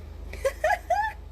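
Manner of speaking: high bright burst of laughter. Real, not acted. Laughing at her own musing on cables, high laugh.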